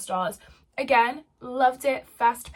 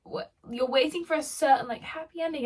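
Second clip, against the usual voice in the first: ordinary talk, nothing marked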